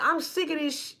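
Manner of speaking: put-on the smart-mouthed kid talking back; retold outburst.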